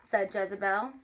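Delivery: ordinary delivery, nothing marked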